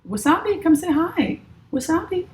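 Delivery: high cooing call to a kitty; high sing-song pet call; calling the cat. Real, not acted. Calling her cat over.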